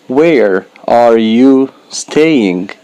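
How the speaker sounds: slow, every word of "Where are you staying?" spaced out; teacher models "Where are you staying?" slowly, word by word; slow careful model. Shown as a demonstration. Teacher to beginners, slow deliberate model. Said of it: "slow model then natural".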